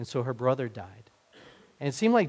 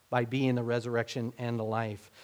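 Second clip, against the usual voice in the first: usual speaking voice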